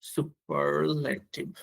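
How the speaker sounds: very slow, stretched syllables; slow dictation. Real, not acted. Teacher writing the term for learners, slow dictation. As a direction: sound the word out piece by piece while writing. Says the word while writing it.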